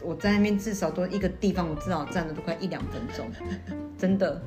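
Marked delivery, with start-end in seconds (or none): none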